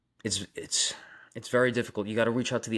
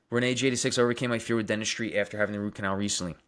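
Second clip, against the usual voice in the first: ordinary delivery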